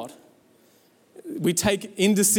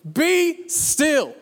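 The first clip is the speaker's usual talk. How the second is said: high, ringing command to a storm; hammed-up commanding call; 8 semitones higher than usual. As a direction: order it still with full authority. Acting Jesus commanding the storm.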